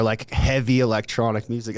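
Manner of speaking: normal talking voice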